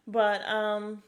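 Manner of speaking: ordinary delivery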